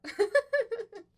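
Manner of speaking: high, loud falling giggle; laugh. Real, not acted. Vlogger joking about content for video, giggling. Amused at own unkind choice.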